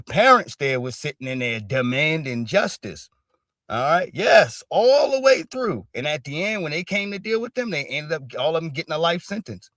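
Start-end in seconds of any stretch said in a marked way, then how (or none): 4.1-5.9 high, loud, slow insistence; a firm parent voice; emphatic parents' voice. Retold or imitated. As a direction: drive it home as the parents. Voicing parents demanding justice.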